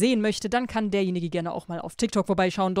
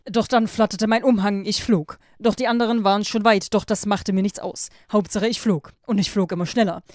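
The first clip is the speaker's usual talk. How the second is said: louder, lively read-aloud narration; story reading; 5 dB louder than usual. A narrator's reading. YouTuber reads a Wattpad story to listeners, lively narration.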